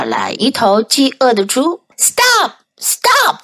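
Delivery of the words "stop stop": very high calls to halt; crying out. A narrator's reading. Hungry pig calls to the runaway, high-pitched cry. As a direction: call out high, word by word. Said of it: "cried the pig".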